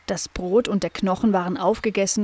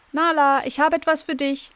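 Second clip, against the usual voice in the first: higher, called out to the dog; aunt calling out a treat; 7 semitones higher than usual. A narrator's reading. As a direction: call her over cheerfully. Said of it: "rief da Tante Miriam".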